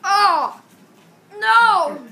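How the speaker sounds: loud anguished yell; shouting. Real, not acted. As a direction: cry out in dismay. His teammate dies in game.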